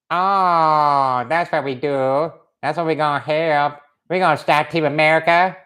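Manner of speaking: put-on caricatured Korean accent, theatrical menace; drawn-out words in a mock Korean dictator voice; North Korean villain. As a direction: scheme as a cartoon Korean despot. Plays North Korean movie villain.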